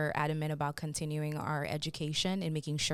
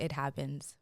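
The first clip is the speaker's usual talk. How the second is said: normal talking voice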